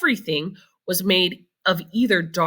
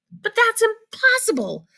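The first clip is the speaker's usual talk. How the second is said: put-on much higher child's voice, amazed; little boy voice. A narrator's reading. Charlie doubts his grandfather, in a high child's voice. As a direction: pitch it up like a small boy. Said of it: "said little Charlie".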